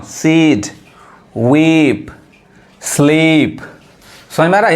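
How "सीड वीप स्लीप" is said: "सीड", "वीप", "स्लीप" each with a long ee; slow word-by-word drill, stretching the vowels of "सीड, वीप, स्लीप". Shown as a demonstration. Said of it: "बड़ी long sound पढ़ा रहा है".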